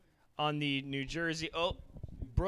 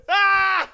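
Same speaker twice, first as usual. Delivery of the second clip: a loud high laugh; high shrieking laugh; 14 dB louder than usual. Real, not acted. Fan cracking up at his own heckle impression, high shrieking laugh. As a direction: cackle at your own joke.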